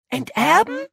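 high, rising astonished question; startled outburst. A narrator's reading. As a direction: echo the word in high-pitched disbelief. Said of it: "entfuhr es Max und Paula". (verblüffte Rückfrage, hoch und steigend)

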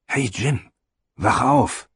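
high, lively wake-up call. Acted. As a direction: call out brightly to wake him. Jim is woken by call.

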